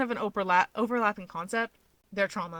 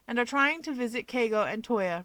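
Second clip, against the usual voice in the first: usual speaking voice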